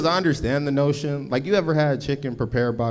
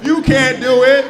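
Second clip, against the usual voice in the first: villain shout; 12 semitones higher, 9 dB louder than usual. Acted. Acted yelling scene.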